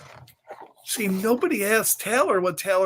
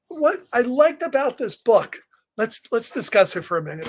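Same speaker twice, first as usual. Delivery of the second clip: ordinary delivery, nothing marked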